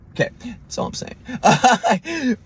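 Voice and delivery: laughing. Real, not acted. Laughing aside.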